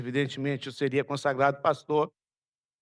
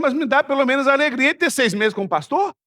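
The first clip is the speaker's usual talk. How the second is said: louder, higher ironic comeback; indignant retort; 9 semitones higher, 9 dB louder than usual. Retold or imitated. Pastor retelling his retort to the traitor, loud and ironic. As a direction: throw the sarcastic question back at him.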